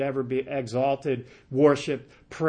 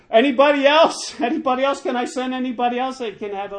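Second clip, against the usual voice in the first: mock appeal; 8 semitones higher, 5 dB louder, faster than usual. Acts out Joab seeking another messenger.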